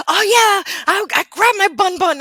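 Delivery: high, loud enthusiastic quote; imitating an excited coworker; eager coworker. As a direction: exclaim it with big relish. Acting coworker's eager reply about rice.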